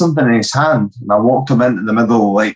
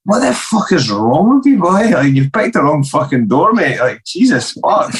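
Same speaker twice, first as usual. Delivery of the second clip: much higher shouted telling-off; retold angry shout; 6 semitones higher than usual. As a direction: scold the thief who picked the wrong door. Teller shouts at the thief.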